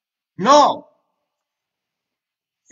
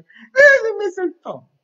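queja llorosa imitada; 12 semitones higher, 6 dB louder, slower than usual. Retold or imitated. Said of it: "Muchos de ustedes piensan".

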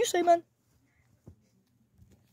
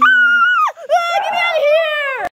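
screaming in panic; high terrified shout; panicked scream; 10 semitones higher, 6 dB louder, slower than usual. Acted. Fleeing a scary place, screaming.

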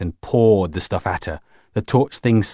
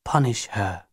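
hoarse and soft; 7 dB quieter than usual. A narrator's reading. Beth orders the machine onto Grinny, hoarsely and softly. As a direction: say it softly with a husk. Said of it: "said hoarsely and softly".

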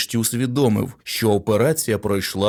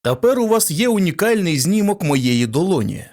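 sarcastic; 8 semitones higher than usual. A narrator's reading. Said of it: "саркастично промовив Кент".

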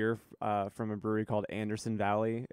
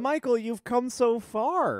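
high, slow, doting praise; mock proud parent; 11 semitones higher, much slower than usual. Teasing a co-host's progress.

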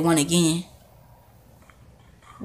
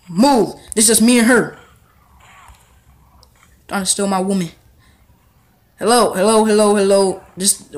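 ordinary delivery

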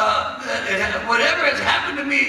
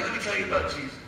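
soft; 9 semitones lower, 12 dB quieter, faster than usual. Retold or imitated. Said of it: "He's like".

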